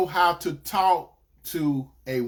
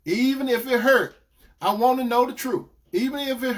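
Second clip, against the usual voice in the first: mock woman's voice, higher and louder, insisting on the truth; woman demanding truth; 7 semitones higher, 3 dB louder than usual. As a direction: lightly play a woman who wants the truth even if it hurts. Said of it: "most women would say".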